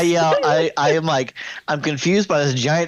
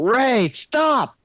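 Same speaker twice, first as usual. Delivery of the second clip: mild mock shout; 8 semitones higher, slower than usual. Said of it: "I shout".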